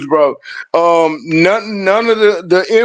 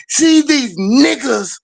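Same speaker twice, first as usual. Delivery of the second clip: drawn-out, higher-pitched emphatic quote; with conviction; 5 semitones higher, slower than usual. Retold or imitated. Quoting the man who used the word, with conviction. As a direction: imitate him, stretching it out with conviction.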